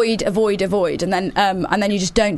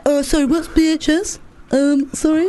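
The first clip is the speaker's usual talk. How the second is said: higher mock voice of the co-host asking "what's B H S"; imitating co-host; 6 semitones higher than usual. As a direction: mimic your clueless co-host. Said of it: "that is my voice".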